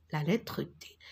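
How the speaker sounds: normal talking voice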